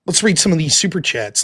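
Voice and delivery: ordinary delivery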